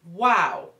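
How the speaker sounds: loud shocked wow; hurt parent reacting to teen, shocked wow; stunned wow. Shown as a demonstration. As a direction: gasp it out like you've been hit. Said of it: "what I call the wow factor".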